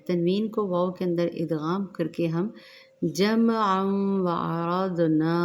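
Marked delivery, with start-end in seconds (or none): 3.0-5.3 जमअन व अरदना with the noon merged into the waw; slow, nasal blend of जमअन into व; idgham demo. Shown as a demonstration. Teacher demonstrates idgham in जमअन व अरदना slowly. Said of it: "ऐसे पढ़ेंगे".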